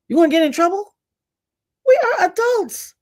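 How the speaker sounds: high-pitched, exasperated outburst; exasperated incredulity. Real, not acted. Exasperated at adults fearing directness.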